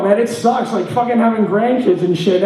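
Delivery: ordinary talk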